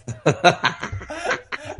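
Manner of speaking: long, helpless laugh; hearty laugh. Real, not acted. Storyteller cracks up after confessing the punch, hearty laugh. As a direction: dissolve into laughter at your own tale.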